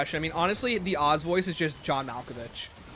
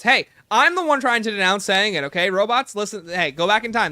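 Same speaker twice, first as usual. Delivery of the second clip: loud protest; 6 semitones higher, 8 dB louder than usual. Pretend. Defends himself to robots.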